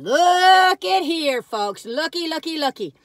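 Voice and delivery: loud, slow look at here; excited showing off. Real, not acted. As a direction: call them in with glee.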